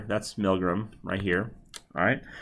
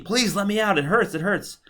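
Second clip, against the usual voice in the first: a pitched-up, half-hearted plea of pain; mock pleading; 10 semitones higher than usual. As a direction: plead to be let out. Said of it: "if they're pleading".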